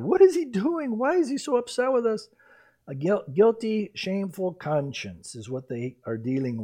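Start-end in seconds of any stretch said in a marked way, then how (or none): 0.0-2.3 worried brothers. Retold or imitated. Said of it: "they're blaming God".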